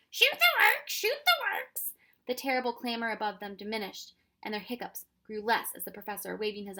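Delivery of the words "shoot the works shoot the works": much higher, loud bird shriek, slowing. Acted. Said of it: "flew around shrieking ferociously".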